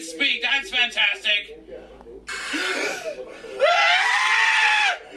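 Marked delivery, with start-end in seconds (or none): 2.3-5.1 sharp high gasp of fright; startled gasp. Real, not acted. Startled by a jumpscare, sharp high gasp. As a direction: gasp as the scare hits.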